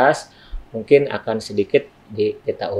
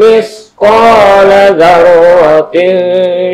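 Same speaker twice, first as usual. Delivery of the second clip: loud, high, slow melodic chant; Quran recitation; 8 semitones higher, 12 dB louder, much slower than usual. Shown as a demonstration. Teacher reciting Surah Al-Zalzalah for students, melodic chant. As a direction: chant it in long-held, sustained notes.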